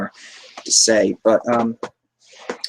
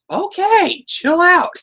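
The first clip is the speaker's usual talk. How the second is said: high, loud mock reaction; mock squeaky reaction; 4 dB louder, much slower than usual. Fan mocking Harvey in a high-pitched voice. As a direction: shoot back a high-pitched "chill out".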